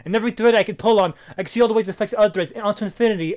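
loud, high, insistent quoted speech; loud quote. Retold or imitated. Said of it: "He's like".

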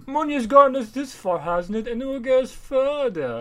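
high, slowed, grand toff voice; mock rich father; pompous rich man. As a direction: sneer it like a smug millionaire. Voicing rich guy.

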